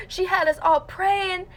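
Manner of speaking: high-pitched tearful imitation of a child; hammed-up upset pupil pleading; mock hysterical. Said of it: "like, hysterical".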